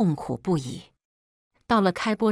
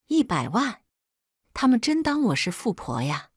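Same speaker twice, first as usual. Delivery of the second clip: higher-pitched, indignant disbelief; incredulous question, pitched up; 5 semitones higher than usual. Acted. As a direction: balk at an outrageous demand. Mother balks at the demand.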